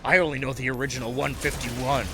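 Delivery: calm menacing drug lord; Kingpin voice. Pretend. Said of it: "my Kingpin voice".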